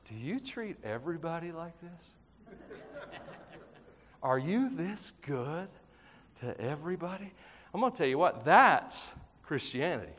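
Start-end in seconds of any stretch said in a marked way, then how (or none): 0.0-7.3 awed hush. Retold or imitated. David praying to God, stunned by his goodness, hushed awe. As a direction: marvel at it quietly, barely above a breath. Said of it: "he says".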